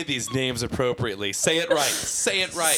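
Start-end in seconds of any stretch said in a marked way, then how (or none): 1.3-2.8 mock demand. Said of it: "demanding correct names".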